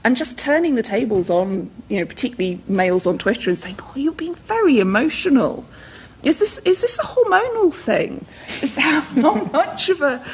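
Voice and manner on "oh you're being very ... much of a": high, mock-concerned taunt with a laugh. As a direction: tease him back, higher and laughing. Mocking men on Twitter.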